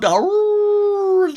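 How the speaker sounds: high loud dog howl. Acted. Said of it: "Treacle howled".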